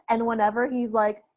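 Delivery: usual speaking voice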